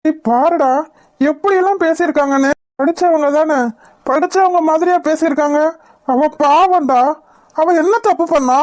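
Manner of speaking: high-pitched, agitated outrage; anguished, indignant appeal; distressed protest. Acted. Upset son defends his wife.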